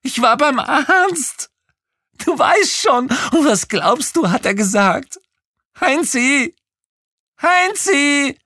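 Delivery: very high, loud shrill female voice; shrieking woman on phone. Acted. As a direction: screech it like an excited woman on the line. Kreischende Anruferin spricht weiter. (kreischende Frauenstimme am Telefon)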